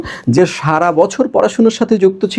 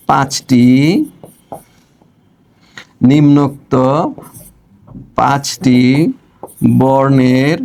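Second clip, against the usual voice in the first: words dropped one by one with long pauses, as if writing on the board; slow dictation; 4 semitones lower, slower than usual. Acted. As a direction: dictate slowly while writing it out. Dictating question while writing. (ধীরে ধীরে শ্রুতলিপি দেওয়া)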